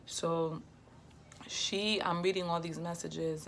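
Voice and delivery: ordinary talk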